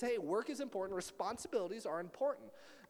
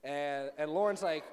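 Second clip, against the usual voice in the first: usual speaking voice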